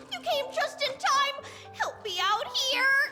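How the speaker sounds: high, excited cry for help; high-pitched appeal to an ally; urgent plea. Acted. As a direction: call your friend in to rescue you. Begs a friend for help.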